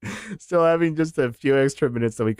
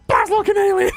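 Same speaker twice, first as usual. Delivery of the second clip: laughing shriek; 3 dB louder than usual. Real, not acted. Losing it laughing.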